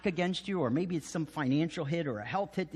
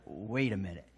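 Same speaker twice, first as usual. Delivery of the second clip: softer and lower, dawning dread; hushed realization; 9 semitones lower, 6 dB quieter than usual. Retold or imitated. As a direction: murmur it as it sinks in. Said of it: "they're like".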